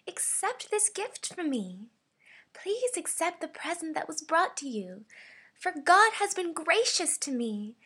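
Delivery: high, lilting sweet voice; kindly, sweet. Shown as a demonstration. Voice actor auditioning a gift-offering line, kindly and sweetly. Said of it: "The first one is the kindly slash sweetly read".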